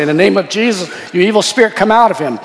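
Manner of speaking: loud, high, forceful order to a spirit; acted exorcism command. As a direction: order the demon out, re-enacted. Acts out casting out a demon.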